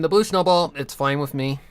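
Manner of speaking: ordinary talk, nothing marked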